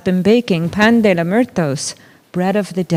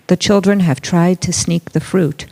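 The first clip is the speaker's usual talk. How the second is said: ordinary delivery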